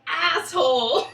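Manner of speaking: loud, high, drawn-out jeer; mock outraged shout. Fan jeering at the TV mom's remark, mock outraged shout.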